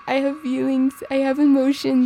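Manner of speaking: lightly hammed-up tearful confession; mock teary-eyed. Imitating a character who gets all teary-eyed, mock tearful. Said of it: "he gets all teary-eyed".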